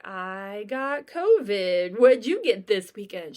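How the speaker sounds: dramatic complaint. Retold or imitated. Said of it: "I'm gonna be like".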